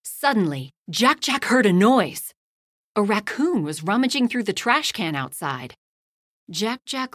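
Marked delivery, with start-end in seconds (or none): none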